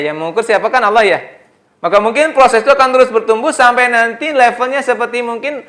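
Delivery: high, loud and quick, driving the point home; fervent preaching. Acted. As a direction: preach it at full force to the mothers. Preaching at full force.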